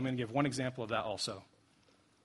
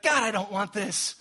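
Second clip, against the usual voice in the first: loud, drawn-out acted cry to God; acted agonized prayer; anguished plea; 6 semitones higher, 6 dB louder, slower than usual. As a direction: plead in dread, higher and louder. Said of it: "He's like".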